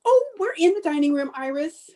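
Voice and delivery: high, quick call to another room; calling back. Acted. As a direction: answer a caller from across the house. Calls back to arriving neighbor.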